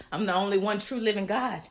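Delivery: words spilling through laughter; laughing while speaking. Real, not acted. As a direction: say it with a laugh in your voice.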